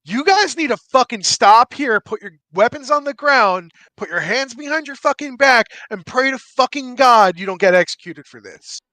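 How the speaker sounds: angry guard. Acted. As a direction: dress them down like an outraged guard. Guard confronts party over tower.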